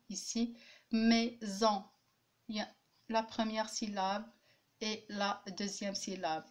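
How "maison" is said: "Maison" divided as mai, then son; a small break inside "Maison"; two separate syllables. Shown as a demonstration. Teacher comparing two-syllable words, split in syllables. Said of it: "Il y a deux syllabes aussi".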